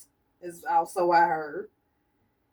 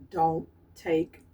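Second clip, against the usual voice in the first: ordinary delivery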